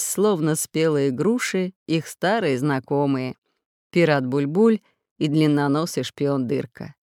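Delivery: ordinary delivery, nothing marked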